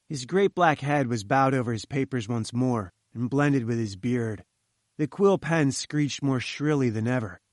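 usual speaking voice

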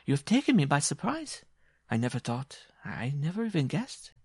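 high, swooping woman's voice; amused giantess feigning surprise. Acted. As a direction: feign surprise to the little suitor.

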